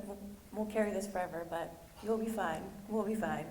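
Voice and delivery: normal talking voice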